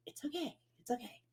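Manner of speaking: calm a crying little buddy softly; soft comforting whisper to a child; soothing a kid. Retold or imitated. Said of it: "I have to be like".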